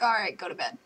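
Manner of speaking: low, dismissive brother voice; imitated brother. Brother after getting water, low and dismissive. Brother sending sibling to bed.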